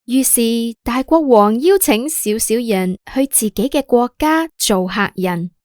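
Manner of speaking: usual speaking voice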